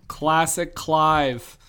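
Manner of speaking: very slow, stretched-out tagline; savouring it. Acted. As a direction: draw out both words like a slogan. Savouring a running joke.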